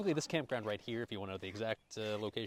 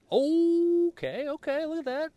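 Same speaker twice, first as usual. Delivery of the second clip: higher and much louder, thrilled at the food; excited; 12 dB louder, much slower than usual. Real, not acted. Checking the grill, calling out excitedly to viewers. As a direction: burst out happily at the crisping food.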